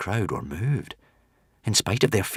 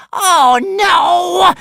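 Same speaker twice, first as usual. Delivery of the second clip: very high, loud dismay; anxious hissing witch; 13 dB louder than usual. A narrator's reading. Witch sees the crowd turning, loud anxious dismay. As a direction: cry out as an alarmed witch. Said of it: "hissed the witch, looking round anxiously".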